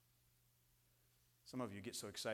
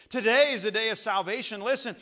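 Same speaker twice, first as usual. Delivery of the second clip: loud, high-pitched pulpit delivery; urgent preacher at full volume; full-force preaching; 9 semitones higher, 14 dB louder than usual. Real, not acted.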